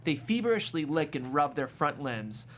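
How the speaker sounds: ordinary talk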